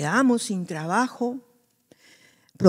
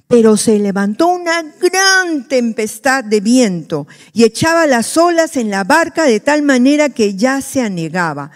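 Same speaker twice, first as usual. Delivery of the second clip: scripture reading; 2 semitones higher, 5 dB louder than usual. Acted. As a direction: read the verse out to the congregation. Reads the scripture passage aloud.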